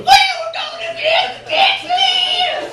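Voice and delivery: very high, piercing mock kid yell; gleeful window yell. Neighbor kids hanging out the car window, yelling gleefully. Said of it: "hanging out the window going".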